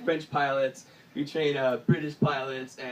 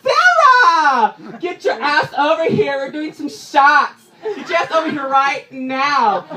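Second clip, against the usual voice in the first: high, loud shout across the room; hammed-up girl calling her friend over; party girl yelling; 7 semitones higher, 10 dB louder, slower than usual. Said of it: "This girl on the other side goes".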